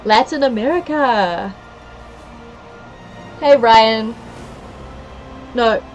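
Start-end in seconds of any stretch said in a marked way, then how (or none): none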